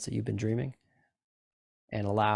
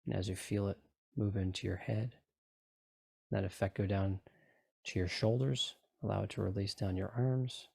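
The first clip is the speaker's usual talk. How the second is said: guided meditation. Acted. Continues the relaxation induction.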